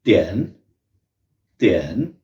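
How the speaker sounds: त्यन said short with a falling fourth tone; fourth tone tian. Shown as a demonstration. Teacher models the syllable त्यन. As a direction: clip त्यन short and let it fall. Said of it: "ये बनेगा".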